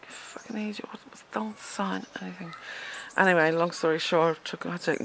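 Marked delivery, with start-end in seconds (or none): 0.0-2.5 hissed angry whisper; furious whisper. Retold or imitated. Sister warning her brother, in an angry whisper. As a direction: whisper it like a fierce rebuke.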